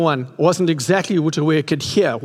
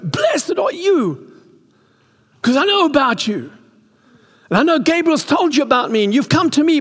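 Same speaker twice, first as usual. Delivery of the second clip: Elizabeth's joyful greeting; 10 semitones higher than usual. Acted. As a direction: voice Elizabeth overjoyed to see Mary. Voicing Elizabeth to Mary.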